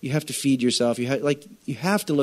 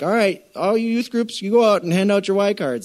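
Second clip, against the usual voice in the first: mock announcer call; 7 semitones higher than usual. Organizer calling out to youth groups.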